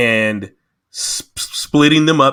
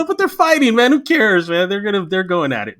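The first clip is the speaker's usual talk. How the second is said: animated self-justification; 9 semitones higher than usual. Retold or imitated. Acting out own change of mind.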